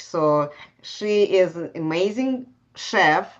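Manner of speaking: normal talking voice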